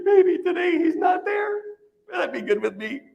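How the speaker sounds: hopeful high squeak. Retold or imitated. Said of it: "And I'm thinking".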